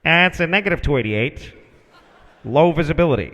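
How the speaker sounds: matter-of-fact refusal, pitched a little low; tower controller. Retold or imitated. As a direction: deliver it as a routine radio denial. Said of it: "listen to the tower talk to the area airplanes".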